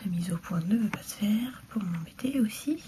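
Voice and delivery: softly whispered annoyance; whispered grumble. Real, not acted. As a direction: mutter it under your breath. Whispered complaint about camera focus. (râlerie chuchotée)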